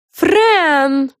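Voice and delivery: high, loud, cross shout of a name; angry call. A narrator's reading. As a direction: call out sharply to scold. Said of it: "раздался сердитый голос".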